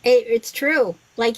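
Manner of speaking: normal talking voice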